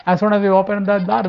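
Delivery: usual speaking voice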